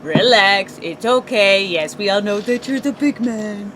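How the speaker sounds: low, soft condescending calm; mock soothing. Calming down big angry men, low mock-soothing voice. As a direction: soothe him like an upset child, teasing.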